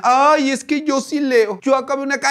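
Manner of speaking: mock a smug pretend intellectual; snooty know-it-all. Vlogger mimicking a smug commenter who thinks she's smart. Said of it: "como la del té divina".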